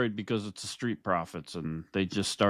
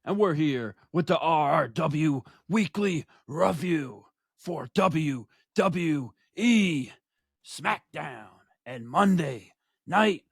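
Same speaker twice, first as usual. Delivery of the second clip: slow, punched ring-announcer intro with big pauses; show-opening announcer; 7 semitones higher, slower than usual. Acted. Host introduces the show and tag team partner, announcer style. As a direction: announce each name like a main event.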